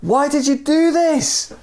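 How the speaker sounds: high, slowed mock yell; a high, slow cry of disbelief down to the stranger; storytelling yell. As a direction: shout the question down over the edge. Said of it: "looked over the edge and yelled".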